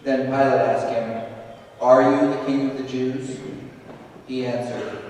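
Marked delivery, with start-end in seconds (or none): none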